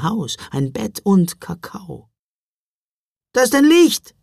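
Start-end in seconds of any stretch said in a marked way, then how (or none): 3.3-4.1 high, loud cry of relief; relieved shout. Acted. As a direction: call out, hugely relieved. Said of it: "rief er ungeheuer erleichtert".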